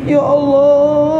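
very high, long-drawn cry; imitated tearful shout. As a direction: wail out to God, stretching the name. (menirukan teriakan tangis yang melengking panjang)

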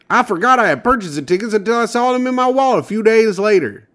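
high, put-on small-town man voice; Michigan lottery winner impression. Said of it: "he said".

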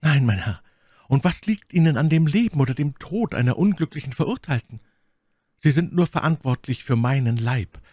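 Frauenfigur, höher als die Erzählstimme; My Lady, eindringlich flehend. A narrator's reading. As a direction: plead proudly as the condemned woman. (eindringlich flehende Lady)

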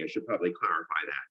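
ordinary delivery

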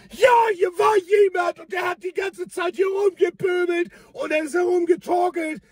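a flustered woman reporting; excited neighbour. Retold or imitated. Aufgeregte Anwohnerin am Hof. (aufgeregte Nachbarin, höher und lauter)

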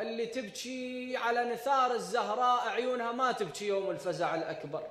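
normal talking voice

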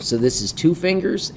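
usual speaking voice

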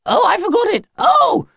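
very high shout of alarm; high shrieking realisation; panicked cry. Acted. Alien aunt panics, forgot torch.

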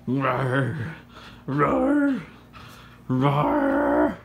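mock beast growl, drawn out; playful roar. Owner play-fighting with dog, pretend roaring.

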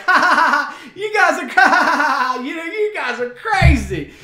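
high, loud laughter through the words; imitating the onlookers cackling at a play fight; mock laughing bystander. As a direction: laugh it off like it's all a game. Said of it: "is, like, laughing".

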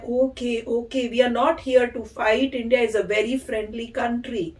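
soft, slow, patient reassurance; imitating a calm Indian soldier, softly; gentle and calm. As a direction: talk them down kindly, very slowly. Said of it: "इतने प्यार से बोल रहे हैं".

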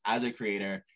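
ordinary delivery

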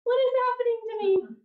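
high, startled near-tearful voice; a panicked, high-pitched wail of confusion; bewildered cry. Retold or imitated. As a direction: act out your own overwhelmed shock at sudden sobbing. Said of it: "I just started sobbing".

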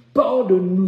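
high, very loud cry of repentance; pleading shout. Real, not acted. Preacher asking God's pardon, crying out loudly. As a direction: burst out begging for mercy. Cries out for forgiveness.

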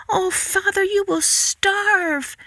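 put-on very high, drawn-out girlish voice; high, slow plaintive girl; daughter's distressed cry. A narrator's reading. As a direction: wail it as a worried child. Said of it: "Marigold cried".

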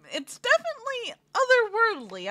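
ordinary delivery

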